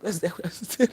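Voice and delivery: ordinary talk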